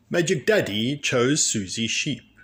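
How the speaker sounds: ordinary delivery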